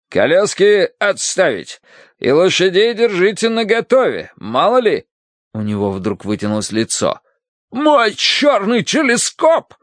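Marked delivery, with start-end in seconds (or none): 0.1-5.1 higher, emphatic orders; commanding colonel. A narrator's reading. Colonel cancels carriages to the staff, commanding. 7.7-9.7 high, drawn-out cry of alarm; alarmed colonel. A narrator's reading. Colonel remembers his prized goldfish, horrified.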